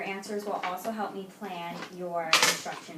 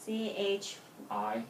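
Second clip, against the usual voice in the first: slow spelling; slower than usual. Real, not acted. Spelling the name back slowly.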